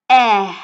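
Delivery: eh said loud and held as German "ä"; half-open mouth on eh, between "ah" and "ay"; German Ä umlaut. Shown as a demonstration. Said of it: "as we pronounce it in German language".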